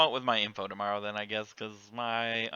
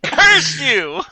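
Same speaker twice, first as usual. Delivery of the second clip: angry shout; 17 dB louder than usual. Pretend. Dead player cursing the group.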